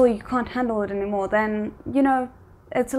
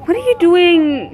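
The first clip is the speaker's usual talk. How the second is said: louder, incredulous scolding; exasperated call-out; 6 semitones higher, 8 dB louder than usual. Real, not acted. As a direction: snap at them in disbelief, louder. Streamer scolding game character.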